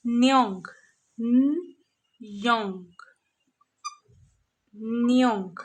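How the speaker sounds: "न्योंग" drawn out slow with a falling tone, as yong; syllable drill. Shown as a demonstration. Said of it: "the first syllable is". ("न्योंग" धीरे, गिरते सुर में, आख़िर में ंग)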